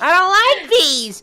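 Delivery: put-on high, slow babyish lisp; a small child whining; Baby Freckle voice. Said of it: "the line you did for Baby Freckle".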